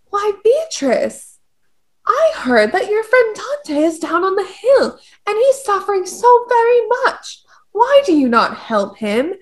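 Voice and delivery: high, hammed-up courtly lady voice, well above her own; mock courtly lady. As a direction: play a swooning saint pleading for Dante. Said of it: "Lucia came down and was like".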